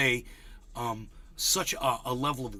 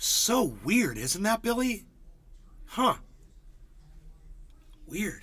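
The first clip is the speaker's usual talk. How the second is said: mock innocent tease; 6 semitones higher, slower than usual. Teasing troll.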